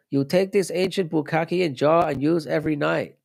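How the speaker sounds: high mock old Japanese lady accent; reading a chat joke in a mock Japanese granny accent. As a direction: voice the herbal store lady in a jokey Japanese accent. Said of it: "Why did I do that in an accent?".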